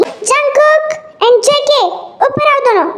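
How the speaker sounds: drawn-out high call; calling upstairs. Acted. Pregnant wife calls both men upstairs, drawn-out high call. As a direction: call them up from downstairs. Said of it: "इतनी जोर से चिल्लाने की क्या बात थी".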